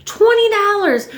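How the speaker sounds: scandalized cry over a twenty-dollar haircut; outraged disbelief. Real, not acted. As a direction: blurt it out, appalled at the price.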